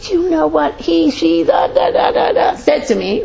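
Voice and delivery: high, mocking dwelling complainer; mock someone retelling a hurt; gossiping complaint. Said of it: "the whole approach of".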